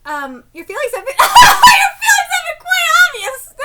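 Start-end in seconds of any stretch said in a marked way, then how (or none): 1.2-3.5 high, loud laughing squeal; laughing while reading. Real, not acted. Fan failing to read the line straight, laughing. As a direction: crack up mid-line, pitched way up. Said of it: "Sorry, let me read this again".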